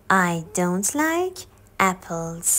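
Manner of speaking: very slow, like stretched, repeated for learners; second slow teaching take. Shown as a demonstration. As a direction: say it again slowly.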